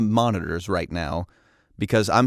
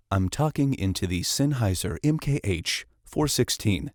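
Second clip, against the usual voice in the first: ordinary talk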